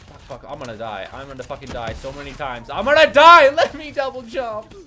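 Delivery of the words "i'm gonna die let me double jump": loud, higher wail; panicked yell. Real, not acted. Player sure he will die, yelling loudly. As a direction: cry it out as death comes.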